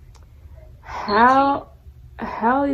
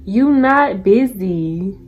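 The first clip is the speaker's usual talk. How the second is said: slow, drawn out; 5 dB louder, much slower than usual. Real, not acted. Calling out liar.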